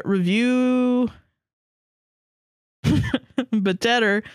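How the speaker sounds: ordinary talk, nothing marked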